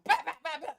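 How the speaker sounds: high, loud mock toddler bawling; hammed-up baby crying; imitated child wail. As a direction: bawl like a spanked little kid. Retold child wailing after spanking.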